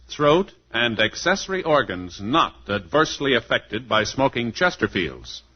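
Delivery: ordinary talk, nothing marked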